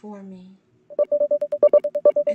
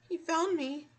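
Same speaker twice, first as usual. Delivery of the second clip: frightened, higher and louder; panicked; 7 semitones higher, 6 dB louder than usual. Acted. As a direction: gasp it in panic. First panicked realization.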